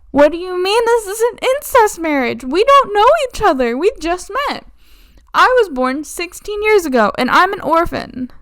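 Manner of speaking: put-on a flustered teenager objecting; shrill young girl. Adeliza protesting she just met him, high girlish disbelief.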